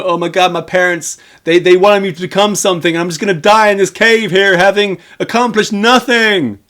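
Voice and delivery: high, loud hammed-up wail; mock regretful lament. James regretting his life in the cave, mock regretful lament. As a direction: build to an over-the-top despairing cry. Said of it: "James's tales of regret".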